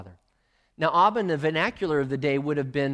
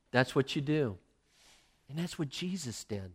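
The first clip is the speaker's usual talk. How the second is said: usual speaking voice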